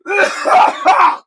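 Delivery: harsh real coughing; coughing up a bug; coughing fit. Real, not acted. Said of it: "I just swallowed a bug".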